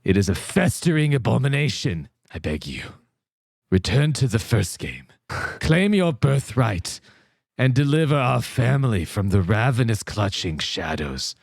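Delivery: low haunting narrator voice; spooky voice. Acted. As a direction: plead ominously like a doomed ancestor. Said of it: "Do it with a voice".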